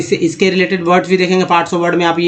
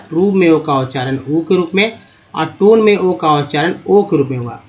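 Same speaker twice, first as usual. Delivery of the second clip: ordinary talk, nothing marked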